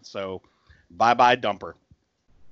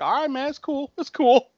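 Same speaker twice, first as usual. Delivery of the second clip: nervous reassuring; 13 semitones higher than usual. Retold or imitated. Nervous grandpa retold.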